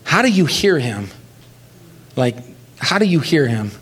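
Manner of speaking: ordinary talk, nothing marked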